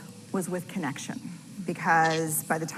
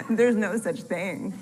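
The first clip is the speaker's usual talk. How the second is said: scoffing through laughter; 3 semitones higher, 4 dB louder, slower than usual. Retold or imitated. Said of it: "And she went".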